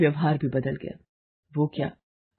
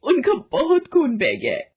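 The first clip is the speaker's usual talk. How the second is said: crying; 9 semitones higher, 3 dB louder than usual. Acted. Tells of brother's bleeding.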